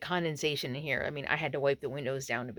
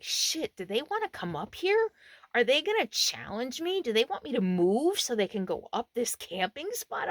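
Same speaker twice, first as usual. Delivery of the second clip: high, rising worried questions; retold alarm; 7 semitones higher, 4 dB louder than usual. Camper wondering if strangers want her spot, retold alarm. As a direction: act out mounting alarm, pitched up.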